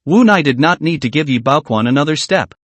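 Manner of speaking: plain reading voice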